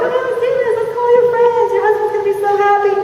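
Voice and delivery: very high, loud gushing recruiter; hammed-up peppy cheerleading; still excited. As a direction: burst out high and giddy, rallying a recruit. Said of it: "is still excited for you".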